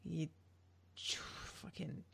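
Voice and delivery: soft unvoiced whispered swear; whispered curse. Real, not acted. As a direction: hiss the curse under your breath. Cursing the character under breath.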